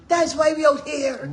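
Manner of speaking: loud rallying emphasis; rallying cry. Real, not acted. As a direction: raise it into an emphatic rally call.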